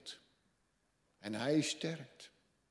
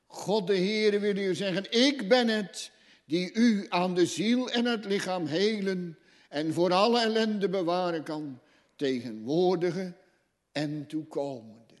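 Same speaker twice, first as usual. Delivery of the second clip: higher, louder pulpit proclamation; preaching at full force; 8 semitones higher, 11 dB louder than usual. Acted. Preacher proclaiming God's healing to the congregation, loudly.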